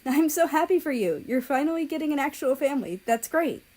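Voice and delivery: joyful congratulating voice; huge smile. A narrator's reading. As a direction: grin through every word. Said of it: "he continued with a huge smile".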